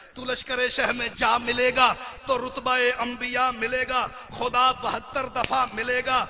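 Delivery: rhythmic verse roll-call building to a shout; poem. Real, not acted. (लय में शेर, आवाज़ बुलंद होती हुई)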